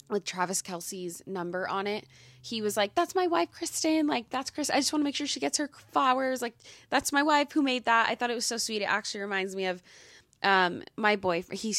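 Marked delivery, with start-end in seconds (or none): 2.9-8.1 imitating an eager husband, high and loud; proud husband. Husband making sure his wife gets her flowers, proudly insistent. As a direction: pitch it up, insisting on her credit.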